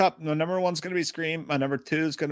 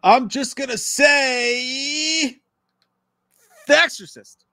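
strained, very slow, higher, through sour candy; eating sour candy; 8 semitones higher, 5 dB louder, much slower than usual. Real, not acted. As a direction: force the words out while sucking a sour candy.

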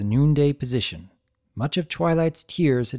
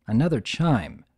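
ordinary delivery, nothing marked

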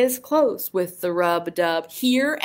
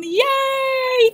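high cheer. Acted.

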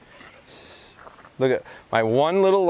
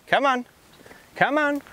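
much higher come on to lure her; high coaxing recall; 13 semitones higher than usual. Real, not acted. Coaxing the dog back.

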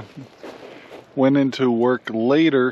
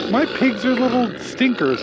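pet talk; 9 semitones higher than usual. Real, not acted. Fond remark about his pigs.

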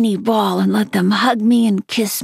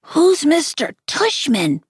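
groggy; 6 semitones higher than usual. Acted. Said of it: "Via said groggily".

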